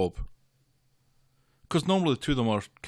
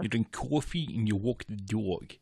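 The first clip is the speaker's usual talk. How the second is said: put-on New York accent; 4 dB quieter than usual. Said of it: "I say car instead of car".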